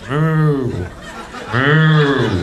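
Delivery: loud, long imitated moo; mooing cow. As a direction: bellow like an arrogant cow. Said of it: "A couple of cows are getting arrogant".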